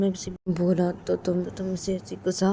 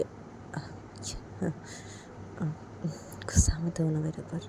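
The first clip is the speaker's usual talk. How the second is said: whispered; 2 semitones lower, 4 dB quieter than usual. Acted. Whispering to the sulking girlfriend, pleading. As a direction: coax her in a whisper. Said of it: "जैसे बोलती कि".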